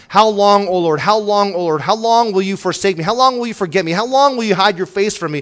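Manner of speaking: high, loud pleading lament; preaching the psalm's anguished how long; impassioned psalm cry. Acted. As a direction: cry out to God as the psalmist, at full force.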